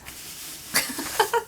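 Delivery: high, breathy delighted laugh; giddy laugh. Real, not acted. Vlogger delighted by how samples feel, giddy laugh.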